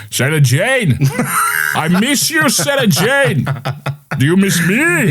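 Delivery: high, wailing alien voice. Acted. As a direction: call out high like a pining alien. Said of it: "I can do a good alien impression".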